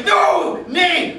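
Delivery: loud eager correction. Acted. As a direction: cut yourself off with a loud no. Self-correcting excitedly.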